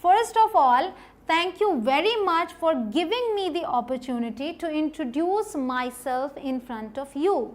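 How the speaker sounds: very slow, deliberate demo line; slow recitation for memorising; slow model sentence. Shown as a demonstration. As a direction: drill it slowly word by word. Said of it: "ये आपको रट लेना है".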